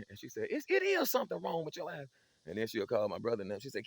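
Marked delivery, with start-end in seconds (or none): 0.5-2.1 high, slowing interrogation; a mother squinting into her son's eyes; suspicious mama. Retold or imitated. Mama insists on his eyes.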